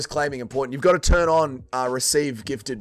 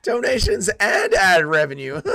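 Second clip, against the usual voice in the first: higher, hammed-up greedy voice; mock gloating; 4 semitones higher, 4 dB louder than usual. Streamer pretending greed over his earnings, high mock gloating. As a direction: crow it with villainous glee.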